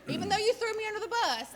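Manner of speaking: high, loud teasing complaint; mock accusation. Real, not acted. Daughter teasing daddy for betraying her, loud high mock accusation.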